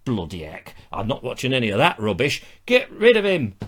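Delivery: mock gruff northerner's accent; North Country accent. Imagining Travis watching the show, hammed-up North Country accent. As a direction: grumble like a put-out North Country viewer. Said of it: "a North Country accent?".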